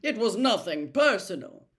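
loud, flustered protest; indignant Percy. A narrator's reading. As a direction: defend yourself, embarrassed.

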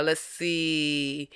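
usual speaking voice

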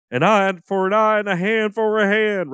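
high, chanting preacher voice; radio Bible preacher. Retold or imitated. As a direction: proclaim it like a radio evangelist. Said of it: "He's always saying shit like".

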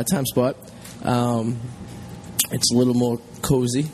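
ordinary talk, nothing marked

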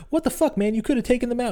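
little sister furious her brother backed down; indignant sister. Retold or imitated. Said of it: "Danny's like".

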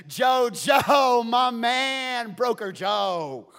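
loud, high delighted hail; excited shout. Acted. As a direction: call out to your star worker, thrilled. Potiphar greeting Joseph excitedly.

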